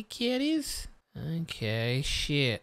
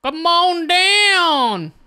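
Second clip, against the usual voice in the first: announcer call; 11 semitones higher, 9 dB louder, much slower than usual. Acted. Game-show style call to enemies.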